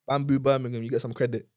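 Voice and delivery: usual speaking voice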